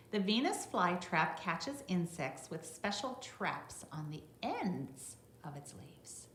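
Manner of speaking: bright read-aloud voice for a class; reading to children. Acted. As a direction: read it out clearly for the little ones. Reads the book text to children.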